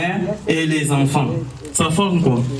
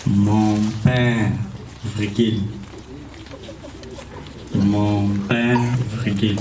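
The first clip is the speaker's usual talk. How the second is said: dictating; 4 semitones lower, 3 dB quieter than usual. Shown as a demonstration. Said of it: "Écrivez".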